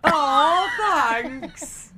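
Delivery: normal talking voice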